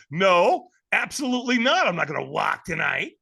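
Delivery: loud, high mock outrage; retold shout easing into firm refusal; emphatic refusal. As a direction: shoot the question down hard.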